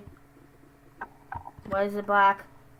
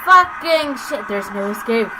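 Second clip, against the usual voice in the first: scared horror game streamer; 11 dB louder than usual. Real, not acted. Monster chasing, no way out.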